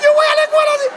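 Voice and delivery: very high, loud tearful squeal; hammed-up child bawling in protest; mock wailing. Child appalled at cut-open shoes.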